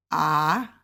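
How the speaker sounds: AH drawn out, rising at the end; second tone. Shown as a demonstration. Teacher demonstrates Mandarin tones on AH, second tone rising. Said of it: "which is second tone".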